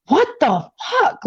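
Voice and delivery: high, incredulous what the fuck; stunned disbelief. Retold or imitated. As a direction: recall the shock of seeing a gun on your brother. Shocked by gun in brother's face.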